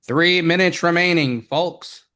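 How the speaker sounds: booming broadcast announcer; loud, high announcer call. Pretend. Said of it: "let me use my special, like, uh, broadcast tool".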